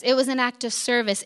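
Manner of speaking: ordinary delivery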